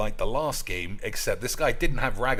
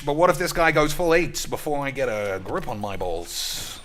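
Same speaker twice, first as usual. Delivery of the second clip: normal talking voice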